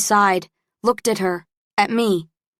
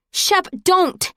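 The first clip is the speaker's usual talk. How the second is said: high-pitched urgent plea; pleading; 12 semitones higher, 3 dB louder than usual. A narrator's reading. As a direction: beg him to stop, voice leaping up. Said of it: "she pleaded".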